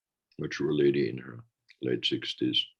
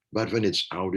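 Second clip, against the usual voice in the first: ordinary talk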